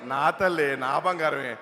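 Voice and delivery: father dropping his pitch low; low-voiced, exasperated sweet talk to his daughter; mock endearment. Father reacts to daughter's confession.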